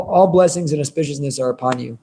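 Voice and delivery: ordinary talk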